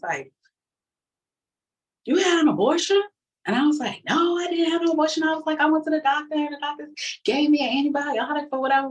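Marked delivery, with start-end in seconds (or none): 2.0-3.0 high, loud incredulous question; accusing mother. Retold or imitated. Mother confronting daughter over the abortion, shocked and accusing. 4.1-8.9 high, rushed protest; indignant denial. Retold or imitated. Daughter lying to her mother about the abortion, high defensive denial.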